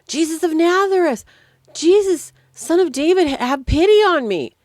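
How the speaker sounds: loud, high-pitched shout for attention; crying out loud. Retold or imitated. Blind beggar calls to Jesus over the crowd, crying out loud. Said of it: "started crying out loud".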